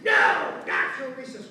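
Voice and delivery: explosive imitated shout on the first word; teacher's yell. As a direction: bellow a refusal as the teacher. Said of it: "And then she yelled".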